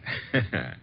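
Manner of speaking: a short, soft amused chuckle. Acted. Archie chuckling at permission to charm Miss Tunis, soft and amused. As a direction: chuckle quietly at the boss's dry joke.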